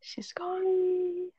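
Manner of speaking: whispered aside. Real, not acted. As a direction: drop to a whisper. Whispering about sister.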